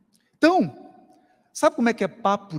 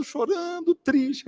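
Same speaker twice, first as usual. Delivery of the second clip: ordinary delivery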